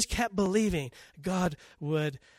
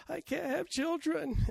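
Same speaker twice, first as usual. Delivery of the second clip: a high, slow, softer lament; sad Hannah; 10 semitones higher, 4 dB quieter than usual. Acted. Hannah answering her husband, in a high, slow lament. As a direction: let it sink, hurt and slow. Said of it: "Hannah sitting on her bed".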